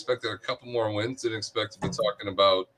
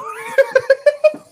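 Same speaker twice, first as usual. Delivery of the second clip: loud, high burst of laughter; big laugh; 10 dB louder than usual. Real, not acted. Reacting to host's joke.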